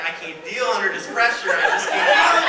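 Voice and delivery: hammed-up panic in the stall; mock panic. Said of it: "and I'm like".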